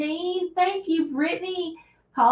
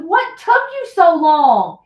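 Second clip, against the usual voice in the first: scolding; 6 semitones higher, 10 dB louder, slower than usual. Retold or imitated. Scolding son after storm.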